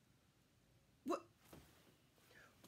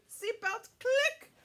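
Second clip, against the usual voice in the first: click; 10 semitones higher, 6 dB louder than usual. Pretend. Buckling up the magic carpet.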